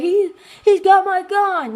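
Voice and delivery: high, slow alarmed call; alarmed cry. Retold or imitated. Quoting a character's alarmed warning.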